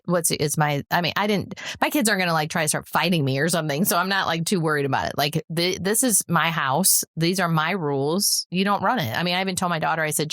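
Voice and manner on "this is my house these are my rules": slow, deliberate weight on "my house" and "my rules"; measured insistence on "my house", "my rules"; emphatic rule-setting. Retold or imitated. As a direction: lay down the law, stressing "my". Lays down parental law.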